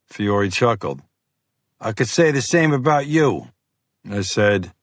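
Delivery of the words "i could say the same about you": lightly voiced gruff FBI agent; pushy, impatient Boston cop. Fiore chuckles back.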